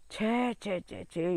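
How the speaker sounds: soft, regretful tuts; hushed storyteller's dismay; dismayed tutting. A narrator's reading. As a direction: lament quietly over a sad loss. Narrator laments the eaten plants.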